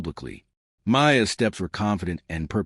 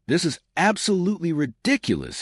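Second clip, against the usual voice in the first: mildly voiced angry explosion; exploding; 8 semitones higher than usual. A narrator's reading. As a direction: burst out in understated outrage. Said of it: "he exploded".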